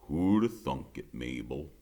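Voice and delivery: a hint of a low, gruff grandpa; lightly voiced old man teasing; grandfather's voice. A narrator's reading. Narrator voices grandfather teasing his wife, lightly.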